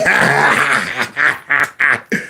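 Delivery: mocking laugh. [name]'s taunting laugh.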